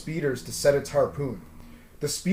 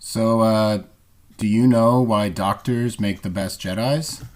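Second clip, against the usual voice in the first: low, louder, slow riddle; lower joke voice; 5 semitones lower, 5 dB louder, slower than usual. Acted. Voice asks a doctor Jedi riddle, low and slow. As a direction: lean into the setup.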